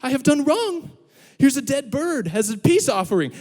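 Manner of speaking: high, pleading penitent-puppy voice; exaggerated, high-pitched apology; mock contrition. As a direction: beg forgiveness as a hammed-up guilty dog. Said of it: "this look of contrition".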